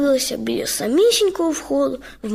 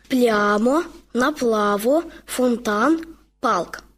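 ordinary delivery, nothing marked

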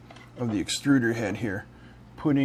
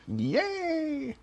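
high, long-held little squeal; mock cheer; 3 dB quieter than usual. Vlogger celebrating a finished assembly step, mock cheer.

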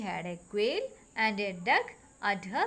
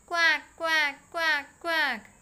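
mock duck quack; 5 semitones higher, 5 dB louder, slower than usual. Shown as a demonstration. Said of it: "which letter sounds quack".

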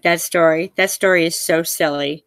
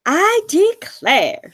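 slow, amazed; 9 semitones higher, much slower than usual. Acted. Hare marvels at the baby.

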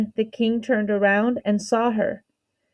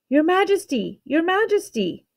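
high-pitched, lightly voiced calls; calling out; 8 semitones higher than usual. A narrator's reading. Servants coming across the bridge toward their king, calling out. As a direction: call for the king in a high voice, without real volume. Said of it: "A chorus of voices came through the air".

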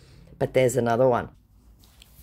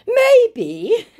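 mock scammer voice; 9 dB louder than usual. Scammer's reply.

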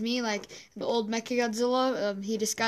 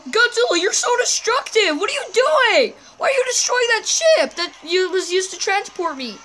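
high, loud, indignant; outraged yelling; 8 semitones higher, 7 dB louder than usual. Acted. Kong yelling at Godzilla for destroying the ship. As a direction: scold him loudly.

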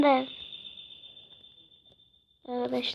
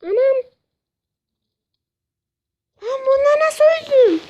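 outraged cry; 8 semitones higher than usual. Real, not acted. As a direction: cry out in disbelief at dying. Dies again, cries out.

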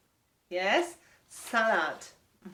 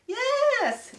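"Yes" soaring high, louder; delighted praise; 4 dB louder than usual. Real, not acted. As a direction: cheer the right guess. Kids guessed right.

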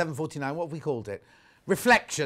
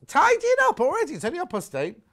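very high, loud, astonished question; incredulous surprise; 14 semitones higher, 6 dB louder than usual. Real, not acted. Host reacting to a viewer's early housework, high incredulous surprise. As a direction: shoot the pitch right up in disbelief.

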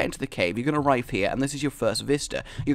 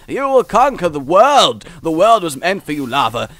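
mock hype; 4 semitones higher, 8 dB louder than usual. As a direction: cheer on the lava like a champion. Hyping up the lava.

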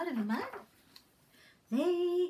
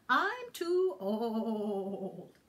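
put-on old goat; 5 semitones lower, much slower than usual. Goat voiced in story.

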